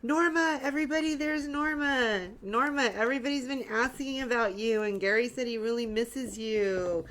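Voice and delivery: high, loud, happy hello to a friend; excited greeting. Real, not acted. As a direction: call out her name with delight. Greeting a viewer by name.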